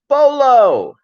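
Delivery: ordinary delivery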